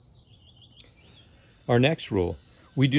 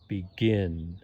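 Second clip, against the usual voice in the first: "begin" with the weight on "gin"; stress on second syllable; 2 semitones lower than usual. Shown as a demonstration. Teacher showing word stress to learners, "begin" stressed on "gin". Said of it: "second syllable stressed".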